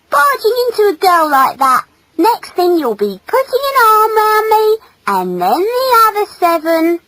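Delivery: high, loud scolding; exasperated, indignant girl; angry female. Acted. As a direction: snap it in high-pitched indignation. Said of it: "cried the female angrily".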